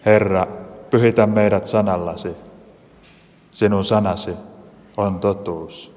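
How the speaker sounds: calm, unhurried spoken prayer. Acted. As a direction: lead the congregation in prayer. Leads a short prayer. (rauhallinen rukous)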